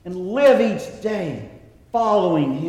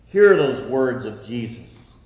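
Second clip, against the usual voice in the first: ordinary talk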